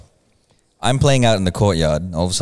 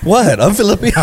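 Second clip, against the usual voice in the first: higher, louder mock interview exchange; playful skit voices; 7 semitones higher, 5 dB louder than usual. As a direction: play both interviewer and excuse. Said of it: "mock job interview skit".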